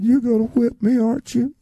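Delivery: put-on high, worried little-boy question; frightened child. Boy asking the house mother if she'll whip him. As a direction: ask it dreading the answer.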